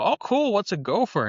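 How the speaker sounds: put-on bright, high eager question as a naive teen; eager kid. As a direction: play your eager thirteen-year-old self asking the boss. Young self asking boss, eager.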